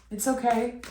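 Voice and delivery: gentle it's okay, steady level; first comforting it's okay at normal volume; reassuring. Real, not acted. Reassuring viewer about past hurt.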